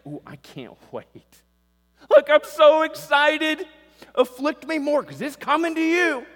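squealing high mock delight; mock gleeful. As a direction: ham up a giddy eager voice. Mock gleeful heart in sermon.

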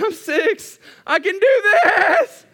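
high, straining full-voiced shout; defiant kid yell. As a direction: belt it out like a determined six-year-old. Child self straining to keep eating.

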